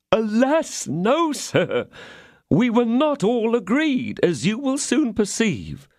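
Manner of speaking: high, slow, begging host; pleading innkeeper. A narrator's reading. Innkeeper pleading with the angry musketeer, high and slow. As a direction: beg to be heard.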